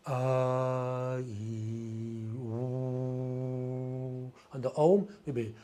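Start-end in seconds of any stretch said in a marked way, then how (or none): none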